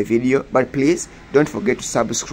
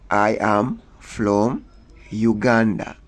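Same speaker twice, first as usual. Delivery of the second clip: slowed for learners; slower than usual. Shown as a demonstration.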